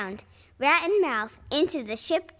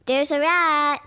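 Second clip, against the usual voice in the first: louder call about the rat; 3 semitones higher, 7 dB louder, slower than usual. Acted. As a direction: call the alarm to the cat. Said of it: "Blackbeard called".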